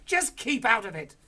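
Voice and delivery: loud, high snapping cry; Mister Cranky cries out at his wife, high and loud; cried. Acted. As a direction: shut her up fast. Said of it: "cried Mister Cranky".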